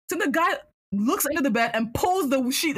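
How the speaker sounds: ordinary talk, nothing marked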